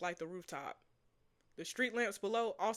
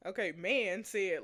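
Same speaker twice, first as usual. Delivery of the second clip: ordinary talk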